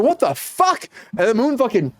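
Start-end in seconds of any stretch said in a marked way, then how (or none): 0.0-0.9 high-pitched retold astonishment; incredulous, voice shooting up on the swear; shocked disbelief. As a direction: exclaim it as a baffled onlooker. Retelling his shock at the throw.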